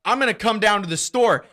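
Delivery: loud, high-pitched caller's threat; hammed-up furious caller; mock angry threat. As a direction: shout it like an irate customer.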